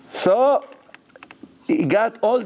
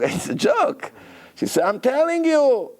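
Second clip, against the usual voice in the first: 1 upset broker; 9 semitones higher than usual. Retold or imitated.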